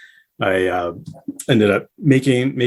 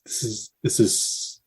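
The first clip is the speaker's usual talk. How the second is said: hushed, slow awe; quiet slow amazed whisper; awed whisper; 5 dB quieter, slower than usual. Retold or imitated. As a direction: breathe out the wonder at the concert. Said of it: "because I was just".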